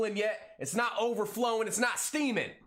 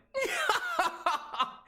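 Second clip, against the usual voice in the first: much higher burst of laughter. Real, not acted. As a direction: crack up at your own pun. Laughing at own dad joke.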